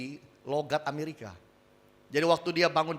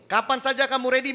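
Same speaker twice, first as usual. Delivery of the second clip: ordinary talk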